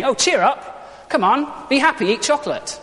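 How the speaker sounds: high, exaggerated pep talk; glib cheering-up. Imitating useless advice to someone depressed or anxious, glibly cheery.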